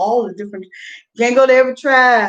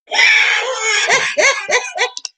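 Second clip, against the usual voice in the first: shrieking laugh; 14 semitones higher than usual. Real, not acted. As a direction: laugh out hard, almost crying. Laughing after bold remark.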